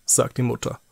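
usual speaking voice

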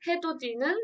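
usual speaking voice

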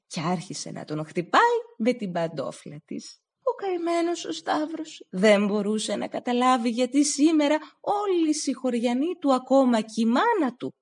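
ordinary delivery

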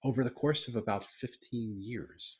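ordinary talk, nothing marked

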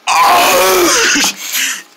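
throaty groan like choking something up; high, hacking groan. Shown as a demonstration. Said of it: "like you're trying to choke up something from your throat".

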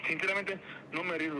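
normal talking voice

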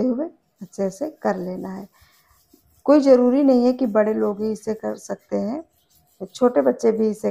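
talking while brushing teeth; toothbrush in mouth. Real, not acted. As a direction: mouth partly blocked by brush. Seen 2: Brush in mouth and actively brushing from 2.2s to 9.2s, briefly out at 0.9s.

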